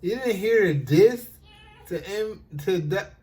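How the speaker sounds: loud disbelief. Real, not acted. Host loudly asks guest how he missed the diss. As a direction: blurt it out, stunned.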